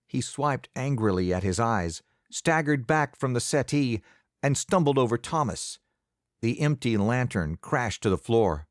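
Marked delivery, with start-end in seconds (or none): none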